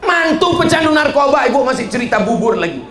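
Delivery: loud, high-pitched comic scolding; mock exasperation. Preacher chides mother over addict son-in-law, exasperated. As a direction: burst out high, then rattle down fast.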